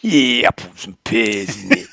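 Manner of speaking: hammed-up Cockney accent; mock Cockney accent, half-hearted. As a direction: lay the Cockney on thick. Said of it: "for the Cockney accent".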